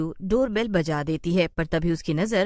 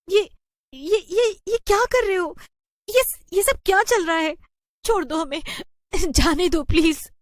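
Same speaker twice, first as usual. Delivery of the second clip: high, loud frightened stammer; panicked pleading; 11 semitones higher, 6 dB louder than usual. Acted. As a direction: beg in terror to be let go. Tied up by witches, panicking. (डरी हुई घबराई आवाज़)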